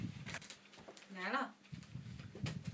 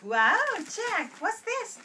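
excited; 10 semitones higher, 9 dB louder than usual. Real, not acted. Showing toddler a book.

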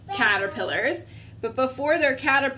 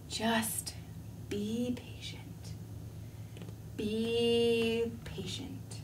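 slow, soft whispered mantra; 10 dB quieter, much slower than usual. Acted. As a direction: drag it out, hushed. Calming mantra.

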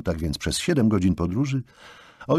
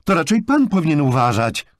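loud, high, sarcastic retort; raised-voice angry comeback; indignant exclamation; 10 semitones higher, 6 dB louder than usual. A narrator's reading. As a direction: cry out as a counterattack. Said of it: "wykrzyknęła".

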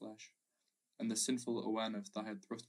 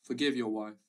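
a louder tearful appeal; sobbing plea; 6 dB louder than usual. A narrator's reading. Wife at husband's knee begs him, tearful plea. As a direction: plead with him through tears. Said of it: "she sobbed".

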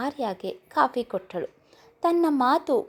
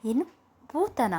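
puzzled question; 4 semitones higher than usual. A narrator's reading. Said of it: "ಪ್ರಶ್ನಾರ್ಥಕವಾಗಿ ಕೇಳಿದಳು".

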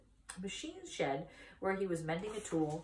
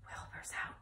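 hushed, whispered news; 10 dB quieter than usual. A narrator's reading. Horses passing on news of the pig's escape, whispering. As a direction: pass it on in a soft whisper.

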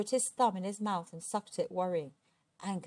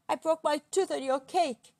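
high, loud wail of pain; pained giant's loud lament; howling; 8 semitones higher, 6 dB louder than usual. A narrator's reading. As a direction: howl the complaint. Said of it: "Angus howled".

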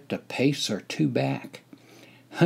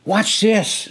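excited, higher call; eager grin before a prank; eager quote; 10 semitones higher, 4 dB louder than usual. Acted. Narrator tells buddies in line, eager. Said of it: "I spontaneously said".

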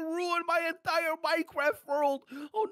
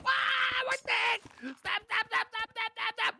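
high-pitched panicked squealing; shrieky, high mock panic; screaming like a little girl; 6 semitones higher than usual. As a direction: squeal in play terror. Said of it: "He is screaming like a little girlie".

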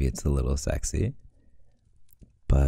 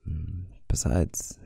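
whispering; 3 semitones higher, 4 dB quieter, slower than usual. Acted. Intimate reassurance.

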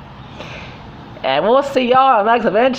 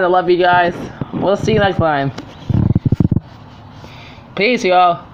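ordinary talk, nothing marked

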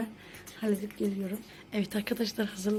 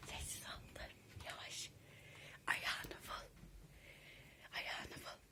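whispering; 13 dB quieter than usual. Real, not acted. Sneaking to tickle the sleeper.

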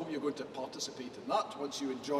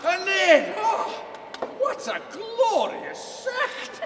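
booming dramatic Scottish recitation; poem recitation; 3 semitones higher, 3 dB louder than usual. Acted. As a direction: declaim the poem with full theatrical force. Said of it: "the address to a haggis".

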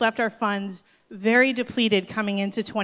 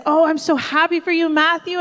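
overjoyed; 7 semitones higher, 4 dB louder than usual. Retold or imitated. Boy says he'll be baptized.

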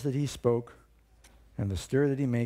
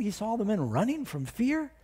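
ordinary delivery

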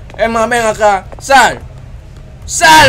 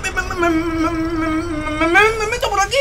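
scared whimper; 9 semitones higher, 6 dB quieter than usual. Real, not acted. Whimpering while squeezing in.

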